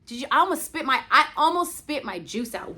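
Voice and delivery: usual speaking voice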